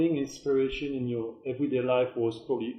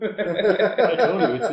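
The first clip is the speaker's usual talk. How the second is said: laughs through the words; laughing; 7 semitones higher, 9 dB louder, slower than usual. Real, not acted. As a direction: laugh while saying it.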